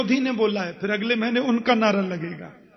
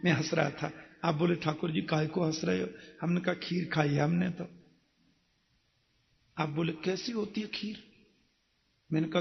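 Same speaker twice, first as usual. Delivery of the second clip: much softer, lower narration; soft storytelling; 6 semitones lower, 9 dB quieter than usual. Acted. Preacher quietly tells the kheer parable, soft and low. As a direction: drop low and hush the story.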